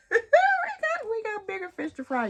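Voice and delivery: high, loud, laughing repeat; giddy laughing emphasis; laughing exclamation. Real, not acted. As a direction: shout it out through laughter. Repeats line laughing, pitched up.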